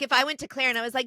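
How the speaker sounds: normal talking voice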